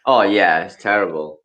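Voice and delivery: ordinary delivery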